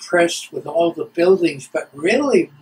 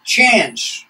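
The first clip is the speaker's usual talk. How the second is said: chief's greeting; 4 semitones higher, 5 dB louder than usual. Retold or imitated. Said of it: "he'd raise his hand and he'd say".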